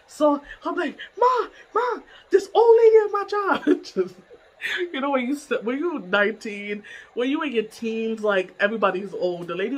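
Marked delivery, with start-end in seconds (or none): 1.2-3.8 high, loud tattling to mom; retold wailing complaint. Nineteen-year-old calling her mom, loud high wail. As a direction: wail it like an upset kid.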